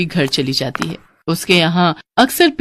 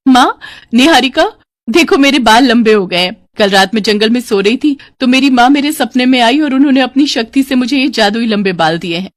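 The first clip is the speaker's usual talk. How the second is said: put-on higher, louder girl eager to share her news; excited daughter; 5 semitones higher, 4 dB louder than usual. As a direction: announce the miracle with delight. (उत्साहित, चहकती बेटी)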